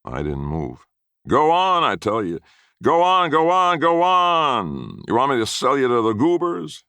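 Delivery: high, loud furious bellowing; raging yell. A narrator's reading. Grandfather raging at the stubborn boy, loud and high. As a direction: rage at the boy who won't move. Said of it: "He yelled and he raged".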